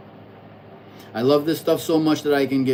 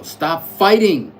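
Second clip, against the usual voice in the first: "Stop fighting" slow and louder; reading the advice aloud, slow and louder; read out firmly; 2 semitones higher, 7 dB louder, slower than usual. Retold or imitated. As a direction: spell out "Stop fighting" slowly with weight. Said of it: "I'm learning how to let go".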